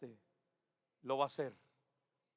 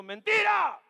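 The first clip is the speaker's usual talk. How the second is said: mock spirit's retort; 11 semitones higher, 10 dB louder, slower than usual. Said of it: "vino el Espíritu y dijo".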